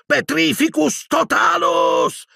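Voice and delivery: very high, drawn-out incantation; screamed spell. A narrator's reading. Boy backing away from the dead, screamed spell. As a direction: scream the curse desperately. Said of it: "urlò di nuovo Harry".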